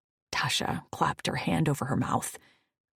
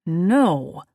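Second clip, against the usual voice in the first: put-on petulant child; 3 semitones higher, 8 dB louder than usual. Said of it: "like a petulant child".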